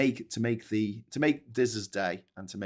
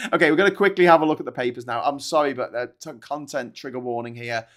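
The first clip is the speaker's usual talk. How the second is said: normal talking voice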